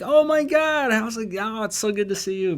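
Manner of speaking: overjoyed surprise at running into a rich friend; excited greeting. Retold or imitated. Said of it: "and I'm like".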